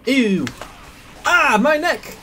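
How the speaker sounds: high loud cry of pain; pained yelp. Real, not acted. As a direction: yelp as the hit lands. Hit by enemy in game.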